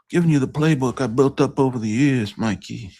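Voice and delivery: high-pitched movie gambler voice; film gambler impression. Quoting a poker film to Mikey, high-pitched impression.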